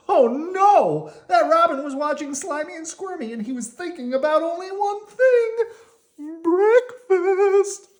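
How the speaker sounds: high, loud storyteller alarm, building; dramatic alarm. A narrator's reading. Narrator warns of the hungry robin, building to a loud alarm. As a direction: build suspense for the kids.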